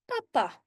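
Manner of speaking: "papa" high, in two short syllables; demonstrated pronunciation. Shown as a demonstration. Teacher shows how double-P "papa" is said, short and high. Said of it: "when you say it, we say".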